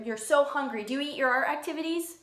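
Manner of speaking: normal talking voice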